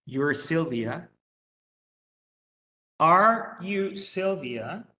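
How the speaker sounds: slow, clear English for learners; careful classroom English, word by word; slow model sentence. Shown as a demonstration. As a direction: spell it out slowly for the class. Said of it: "teacher models statement and question".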